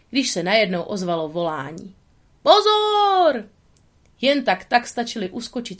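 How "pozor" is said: sudden high yell of warning; warning shout. Acted. A woodsman yells a warning down the path. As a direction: shout to stop them in time.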